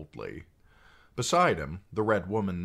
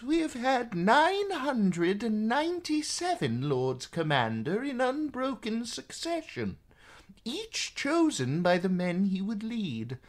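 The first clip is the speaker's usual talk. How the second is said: put-on calm old maester. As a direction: patiently explain tradition. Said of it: "calm as always".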